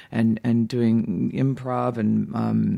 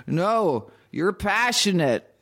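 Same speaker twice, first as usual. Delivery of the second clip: higher and slow, pressing the word passionate; more insistent; 7 semitones higher, much slower than usual. A narrator's reading. Hooker in the joke repeats herself to the driver, more insistent. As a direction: lean on it like he missed the point. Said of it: "a little more insistent this time".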